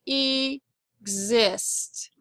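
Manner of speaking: "exist" split as EE...xist; "exist" misread slowly with an open first syllable, ee-xist; long first vowel. Shown as a demonstration. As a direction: stretch a long E to open "exist", then pause before xist. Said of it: "it's going to say".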